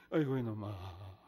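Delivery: a grieving father keening over his sick son; wailing father. Retold or imitated. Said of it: "울기만 울어요".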